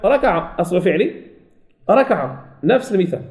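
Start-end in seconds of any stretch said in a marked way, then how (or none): none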